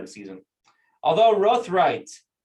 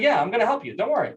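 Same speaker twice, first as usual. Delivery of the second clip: higher-pitched, lightly voiced helper; reassuring demon; 6 semitones higher, faster than usual. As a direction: brush off the worry, pitched up. Demon reassures the rabbi, retold.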